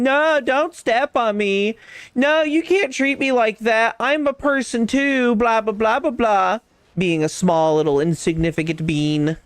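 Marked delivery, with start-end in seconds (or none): 0.0-6.6 high, slow whiny mock of a tiny friend; mocking Pocket. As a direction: whine it like a small complainer. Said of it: "They're constantly screaming".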